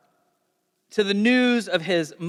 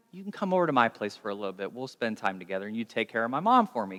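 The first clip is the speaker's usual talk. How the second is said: gentle aside; 8 semitones lower, 7 dB quieter, faster than usual. Acted. Friend kindly inviting the mother.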